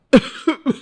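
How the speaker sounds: forced, put-on cough; fake cough. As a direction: cough to fake being sick. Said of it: "बीमार होने का नाटक". (दिखावटी खांसी)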